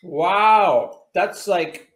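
talking while chewing; chewing candy. Real, not acted. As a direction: mouth partly full. Seen 0: Both hosts eat candy pieces; speaker unclear, mouths chewing throughout.

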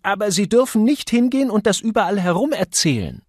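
high, loud woman's admonition; insistent Misses Johnson. A narrator's reading. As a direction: warn him firmly not to spread it. Woman warning the gossip.

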